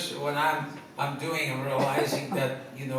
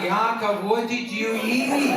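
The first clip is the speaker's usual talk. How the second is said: laughing wife; 7 semitones higher, 4 dB louder than usual. Retold or imitated. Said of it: "She's going".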